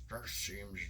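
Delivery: trying to drop the voice very low; slow, deep villain attempt. Shown as a demonstration. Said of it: "I can't even drop my voice that low".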